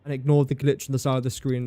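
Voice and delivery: normal talking voice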